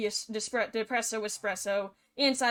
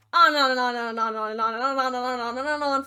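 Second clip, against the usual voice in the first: fast, louder run-on repetition; rattled off; 6 dB louder, much faster than usual. A narrator's reading. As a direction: reel it off like it never ends. Mimics an endless list of guesses.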